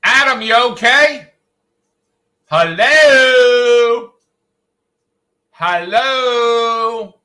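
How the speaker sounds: loud, high calling shout; yelling to wake him. Real, not acted. Yelling at sleeping Adam to wake him up, loud calls. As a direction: bellow his name to rouse him from sleep.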